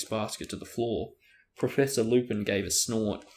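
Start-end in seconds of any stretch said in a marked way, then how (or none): none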